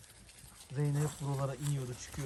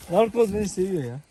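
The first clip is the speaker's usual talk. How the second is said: ordinary talk, nothing marked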